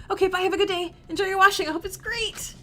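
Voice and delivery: high, bubbly goodbye; flustered cheer. Acted. Frog parent seeing crush off to the washing, flustered cheer.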